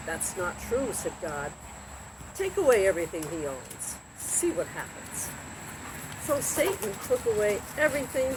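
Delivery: lively storybook reading with voiced characters; reading to children. A narrator's reading. Narrator reading a Bible story to children, lively. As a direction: read the Job story to kids like a bedtime tale.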